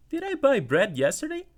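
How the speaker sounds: acting confused about yesterday's shopping; confused question. Shown as a demonstration. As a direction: sound unsure whether you bought it. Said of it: "Aqui eu tô confuso".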